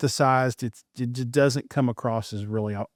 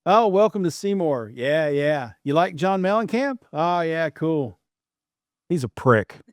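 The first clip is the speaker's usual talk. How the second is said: higher-pitched chipper small-town welcome that drops for the punchline; friendly Seymour local; 5 semitones higher than usual. Acted. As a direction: gush like a welcoming local, then drop to a deadpan jab. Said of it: "let me do a quick impersonation of anyone from Seymour".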